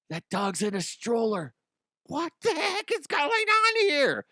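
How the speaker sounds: high, slow incredulous voice; dad hamming up disbelief to his son; mock disbelief. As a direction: play up the shock at the dog in a stroller. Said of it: "and I'm like".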